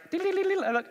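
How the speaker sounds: very high, tinkly fairy trill. Shown as a demonstration. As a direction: flutter the sound up high like a tiny fairy. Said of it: "so one leg".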